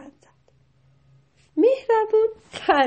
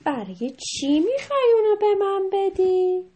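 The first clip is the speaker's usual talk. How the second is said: high, loud questioning cat; cat character voice; 7 semitones higher, 5 dB louder than usual. A narrator's reading. The cat questions Mehrabun, high loud cat voice. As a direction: ask as a puzzled storybook cat. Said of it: "گربه".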